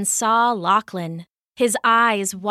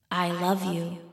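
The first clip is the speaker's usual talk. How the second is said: soft, low, echoing farewell; fading away; 3 semitones lower, 7 dB quieter than usual. A narrator's reading. Mother's last words to her daughter, fading softly. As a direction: let the voice die away softly. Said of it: "fading away".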